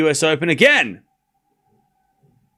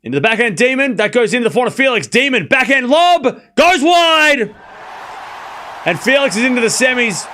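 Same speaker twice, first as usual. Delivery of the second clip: excited commentary shout; 8 semitones higher than usual. Real, not acted. Streamer calling the match-winning point, building to a shout. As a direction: call the winning shot like a commentator.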